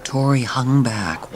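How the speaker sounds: ordinary delivery, nothing marked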